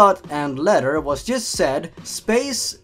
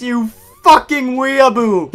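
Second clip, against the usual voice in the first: loud, high insulting shout; mock outrage; 6 semitones higher, 8 dB louder than usual. Narrator berates his Japanese-quoting friend, in mock outrage. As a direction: yell it in hammed-up exasperation.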